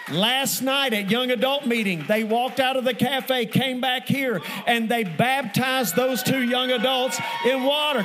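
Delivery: loud, forceful testimony building to a call for praise; rally the congregation at full voice; rousing preacher shout. Real, not acted.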